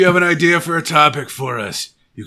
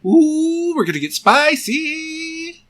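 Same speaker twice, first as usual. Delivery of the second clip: high, gleeful and slow; excited; 5 dB louder, slower than usual. Real, not acted. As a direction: squeal it with playful glee. Excited teasing exclamation.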